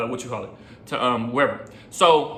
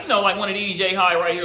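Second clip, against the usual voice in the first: ordinary talk, nothing marked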